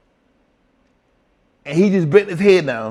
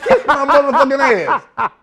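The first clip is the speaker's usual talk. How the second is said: laughing insult; 8 semitones higher than usual. Retold or imitated.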